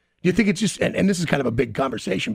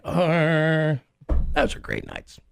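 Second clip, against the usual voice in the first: mock hungover; much slower than usual. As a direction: lightly play someone waking up hungover. Acted hungover morning-after quote.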